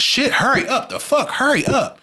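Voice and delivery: high, impatient mock nag; impatient mother. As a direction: rush him with contempt. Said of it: "And he said".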